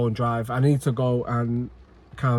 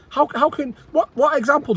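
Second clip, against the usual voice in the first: high exasperated outburst; 12 semitones higher than usual. Real, not acted. Exasperated fan questioning manager.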